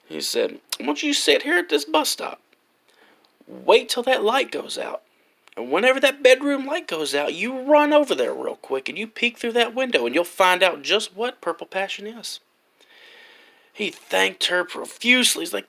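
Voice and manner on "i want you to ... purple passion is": put-on high old lady voice; sly granny setting him up; old lady sending the boy to peek, high. Old lady gives the boy instructions.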